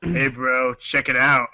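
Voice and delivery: ordinary talk, nothing marked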